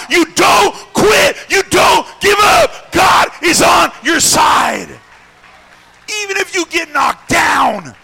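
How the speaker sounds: high, slow, hammered shouts; rally them with every phrase; full-force preaching. Real, not acted. Preacher urges the discouraged not to quit, shouted preaching.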